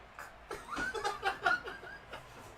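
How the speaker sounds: squeaky breathless laugh; high wheezing laugh. Real, not acted. Laughing fit.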